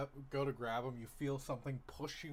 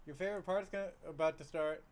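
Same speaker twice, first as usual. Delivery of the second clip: calling out; 5 semitones higher, 5 dB louder than usual. Acted. Parent calls from the TV room.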